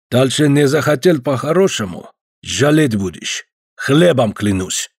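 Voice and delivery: hint of menace with a Caucasian accent; threatening Caucasian accent. Georgian guest threatens the officer, slow Caucasian accent.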